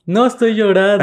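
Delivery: high, loud mock-indignant denial; loud protest. Real, not acted. Girlfriend denies to her teasing partner that she's crying, loud protest. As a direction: protest loudly against the teasing.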